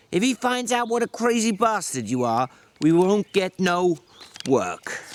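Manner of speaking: a weary ranch hand berating his companion; George, exasperated. Acted. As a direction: snap at him like a fed-up older brother. George instructs Lenny.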